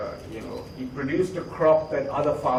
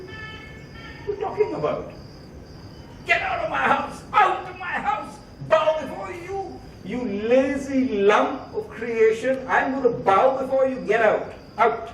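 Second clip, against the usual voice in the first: much higher, shrill-voiced rage; furious father; 10 semitones higher than usual. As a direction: bellow him out of the house. Father enraged, throws son out.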